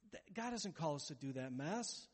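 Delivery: ordinary delivery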